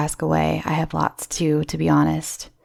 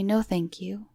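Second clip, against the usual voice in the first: soft, polite "No, thank you."; quietly, politely decline the offer; polite refusal; 2 semitones higher, 7 dB quieter than usual. Retold or imitated. Turning down the creepy photographer, soft and polite. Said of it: "I politely say".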